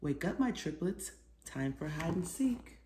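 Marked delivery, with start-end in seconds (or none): none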